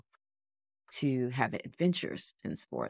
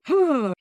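a short, forceful martial-arts yell, much higher and louder; deep kiap yell; 11 semitones higher, 7 dB louder than usual. Shown as a demonstration. Demonstrating the kiap everyone else does in Taekwondo, a sharp yell. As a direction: push all your energy out in one burst. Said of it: "Everybody else would do this deep".

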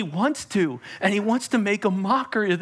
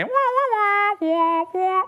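high wailing "wah wah" like a sad horn; mock a losing-game jingle with the voice; sad trombone sound effect; 14 semitones higher, slower than usual. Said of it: "Sound effects are free".

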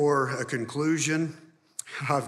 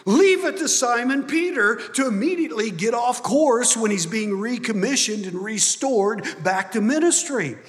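loud, raised preaching to the congregation; full-force preaching; 8 semitones higher, 6 dB louder than usual. Acted. Pastor preaching to the church, loud and driving. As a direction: preach it out with fire and conviction.

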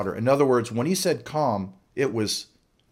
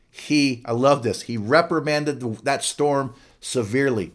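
normal talking voice

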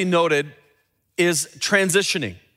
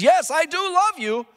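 earnest plea; 9 semitones higher than usual. Acted. Peter insists on his love.

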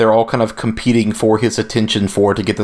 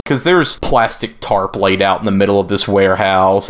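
ordinary talk, nothing marked

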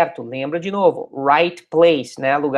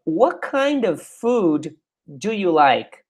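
slow dialogue reading; much slower than usual. Shown as a demonstration. Reading dialogue line slowly.

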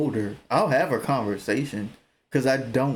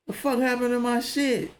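surprised; 9 semitones higher, slower than usual. Retold or imitated. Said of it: "And I'll be like".

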